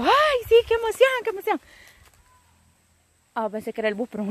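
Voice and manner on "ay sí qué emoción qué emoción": much higher, gushing excitement; excited squeal. Retold or imitated. Thanking a friend for the gift, in an excited squeal. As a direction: squeal it out like a thrilled girl.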